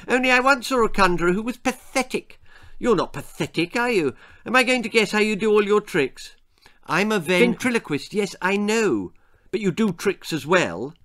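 put-on high, loud, rapid-fire boy; chattering boy Jack. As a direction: gabble pushily like a nosy child.

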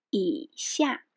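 一下 with the first syllable dipping low then rising, meaning below; 一下 said as yǐxià, dip and rise on 一; falling-rising tone. Shown as a demonstration. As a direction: say 一下 with a dipping third tone on 一. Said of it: "that is falling rising tone".

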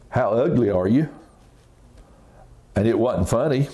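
plain reading voice

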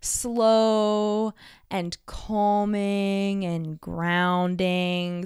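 very slow, drawn-out and calming; slow earth talk. Shown as a demonstration. Demonstrating the slow earthy way of speaking, very slow. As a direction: stretch each word out, grounded and unhurried. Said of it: "So even talking slow is very earth".

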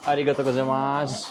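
sing-song politeness, last syllable stretched; Japanese greeting. Shown as a demonstration. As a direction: playfully imitate a polite Japanese thank-you. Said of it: "ಏನಂತೀರಾ ಜಪಾನಲ್ಲೇ?".

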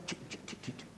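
very soft voiceless ticking; whispery percussion ticks; imitated rhythm ticks. Shown as a demonstration. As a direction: tick out the beats under your breath. Imitating the rumba sounds heard.

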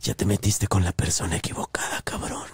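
low unvoiced menacing whisper; spooky whisper. To the extortionist on the phone, a low unvoiced menacing whisper. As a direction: hiss it like a dark priestess. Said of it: "empecé a hablar así como que bien loco".

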